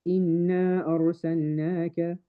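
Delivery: quick recitation, the alif of إِنَّا kept short; recited without mad munfasil. Shown as a demonstration. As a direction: say إِنَّا أَرْسَلْنَاكَ straight through with only two counts on the alif. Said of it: "I don't do the mad".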